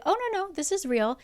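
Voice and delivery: bright and high, reassuring; high peppy reassurance. Retold or imitated. Rhysand reassuring Feyre, high and peppy. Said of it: "And he's like".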